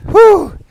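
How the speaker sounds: high loud whew from the cold. Real, not acted. Bitter cold reaction.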